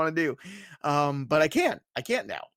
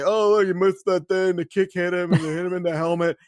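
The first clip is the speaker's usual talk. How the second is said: mocking critics; 3 semitones higher, 3 dB louder than usual. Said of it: "That's how they talk?".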